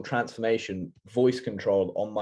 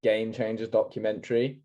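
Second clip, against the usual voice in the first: ordinary delivery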